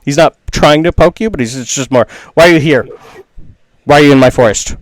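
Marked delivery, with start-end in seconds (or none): 2.3-4.8 loud, insistent interrogation; demanding challenge. Acted. Dandelion guardian questioning travelers in his forest, loud demanding challenge. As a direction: fire the questions loudly at intruders.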